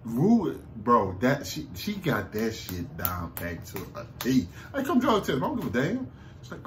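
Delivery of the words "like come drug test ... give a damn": mock dare. Retelling the mother's dare to Rue, high mock taunt. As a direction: dare pitched much higher, with a bit more push.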